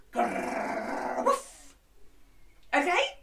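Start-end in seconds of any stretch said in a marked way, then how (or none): 0.1-1.7 playful dog noises; dog growl and woof. Shown as a demonstration. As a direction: growl like a dog, then bark. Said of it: "like a dog in the wash".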